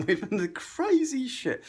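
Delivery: ordinary talk, nothing marked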